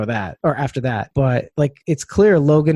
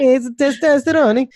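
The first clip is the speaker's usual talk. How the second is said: high, drawn-out fake Italian accent; hammed-up Italian voice, slow and high; mock Italian accent; 10 semitones higher than usual. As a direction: stretch it out in a comic Italian accent. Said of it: "like, an Italian accent".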